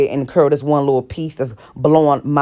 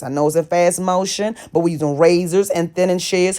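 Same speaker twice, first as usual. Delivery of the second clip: ordinary talk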